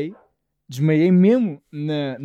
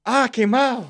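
higher, alarmed cry of a shocked medic; 6 semitones higher, slower than usual. Retold or imitated. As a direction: blurt it out like a startled paramedic. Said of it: "uma reação genuína do profissional".

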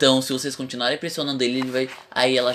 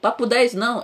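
ordinary delivery

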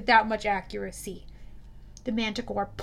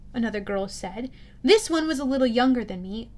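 ordinary delivery, nothing marked

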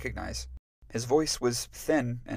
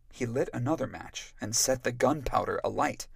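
ordinary delivery

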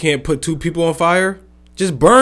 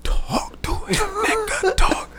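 breathless laughing whisper; 7 dB quieter, slower than usual. Real, not acted. Wheezing through laughter.

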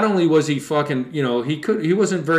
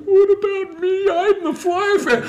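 much higher, slow whiny plea with a laugh; mock whine; slower than usual. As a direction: whine like a jilted fan. Jealous friend whining.

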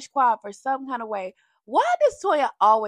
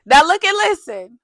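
"Now look and listen" pushed up, louder, calling the room; louder, higher call; 6 semitones higher, 11 dB louder than usual. Real, not acted. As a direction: raise the voice and demand attention: "Now look and listen".

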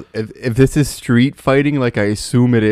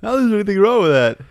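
Seinfeld quote; 11 semitones higher, faster than usual. Retold or imitated. Echoing the Seinfeld line.

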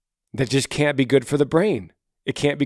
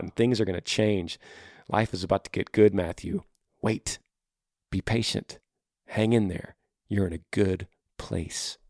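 soft reassurance; 5 semitones lower, 7 dB quieter than usual. Real, not acted. Gentle encouragement to teen.

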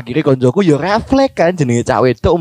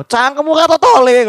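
retold scolding; 9 semitones higher than usual. Acting out someone scolding a kid.

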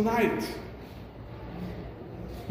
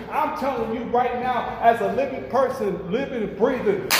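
raised, urgent preacher's voice; fervent preaching; 5 semitones higher, 3 dB quieter than usual. Acted. Preacher warning the congregation about people in hell, fervent. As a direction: press the warning home.

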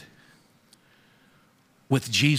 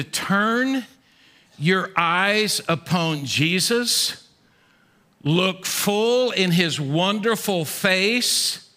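slow hymn recital; 3 semitones higher, much slower than usual. Acted. Preacher closing the sermon with a slow, tender hymn recital. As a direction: linger on each hymn line.

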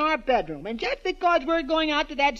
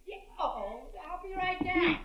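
calling from upstairs; 5 semitones higher, 8 dB quieter than usual. Acted. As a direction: call down from another room.

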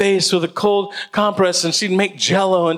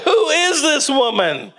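laughing disbelief; 11 semitones higher than usual. Retold or imitated. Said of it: "I'm like".

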